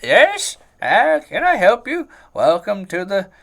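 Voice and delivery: high, loud eager shopkeeper. Acted. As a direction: greet the customer in a high loud voice. Shopkeeper answers the bell.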